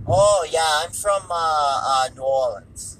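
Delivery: imitated odd accent, vowels drawn out; Tommy Wiseau. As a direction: put on a slurred mock foreign accent. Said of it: "the Tommy Wiseau accent".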